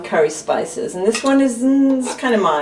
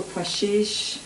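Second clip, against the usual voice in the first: French pronunciation; 7 dB quieter than usual. Real, not acted. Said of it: "You hear that accent?".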